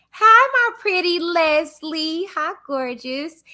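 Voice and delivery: high, slow, sweet sing-song hello; sing-song greeting. Real, not acted. Host cooing a hello to a viewer, sing-song. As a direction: coo the name to a viewer.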